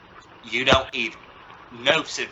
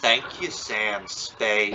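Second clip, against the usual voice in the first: dry sarcasm; 5 semitones lower, 4 dB quieter, much slower than usual. Acted. Father mocks son's detective idea.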